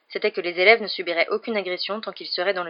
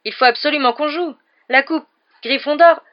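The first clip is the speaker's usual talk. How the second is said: a much higher, louder plea; captain begging the professor; a frantic shout; desperate cry; 8 semitones higher, 9 dB louder than usual. Acted. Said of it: "s'écria-t-il".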